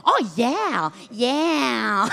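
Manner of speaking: drawn-out mock Acadian accent; mimicking Acadian accent. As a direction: imitate someone copying your Acadian accent, stretching it. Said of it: "ils veulent m'imiter pendant que je parle". (imitation traînante de l'accent acadien)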